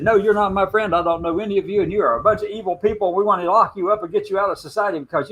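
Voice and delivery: mock-harsh politician voice. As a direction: ham up a cold politician. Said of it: "turned on them and said".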